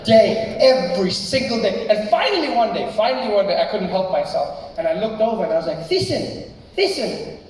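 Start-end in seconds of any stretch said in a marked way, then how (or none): 0.0-1.9 loud, drawn-out words, one by one; slow emphatic repetition. Real, not acted. Comic stressing how often it happened, loud and drawn out. Hammering home the repeated daily ritual. 5.9-7.4 high, urgent name-calling; calling his friend. Retold or imitated. Schoolboy interrupting his friend at lunch, calling his name high.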